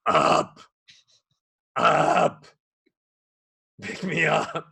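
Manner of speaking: mock-gruff little kid copying dad; gruff toddler. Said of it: "if my dad talks like this".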